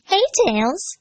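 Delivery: put-on high, bright girl's greeting; cheerful high-pitched hello; cheerful Amy. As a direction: greet him cheerfully as a young girl. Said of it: "Amy said cheerfully".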